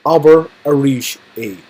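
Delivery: slow, carefully drawn out; slow teacher model; slow repeat. Shown as a demonstration. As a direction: say it slowly for learners to copy. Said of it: "Say it again".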